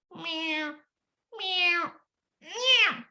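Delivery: cat replies to dog; cat meow. Acted. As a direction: mew like a cat.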